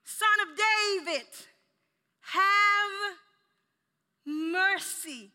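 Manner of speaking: loud, high, drawn-out plea; desperate cry. Acted. Preacher as blind man begging Son of David, desperate cry. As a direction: cry out for mercy, stretching the key words. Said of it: "There was a desperation in his voice".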